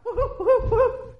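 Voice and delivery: high-pitched "woo-hoo" whoops; mock whooping; mimicked worship whoops. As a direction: imitate excited shouters with high woo-hoos. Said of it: "I've seen people jumping down, shout".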